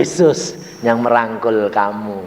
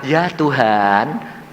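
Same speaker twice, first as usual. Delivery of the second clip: slow, drawn-out reply with rising end; imitated slow, submissive address; reverent answer; much slower than usual. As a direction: stretch it out devoutly to the Lord. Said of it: "Saya bilang".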